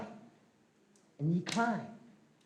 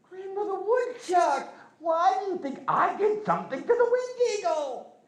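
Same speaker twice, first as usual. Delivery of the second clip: put-on higher, innocent trickster boy voice; young Glooskap; 13 semitones higher, 3 dB louder than usual. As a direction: play the cheeky boy feigning innocence. Glooskap dodges the question.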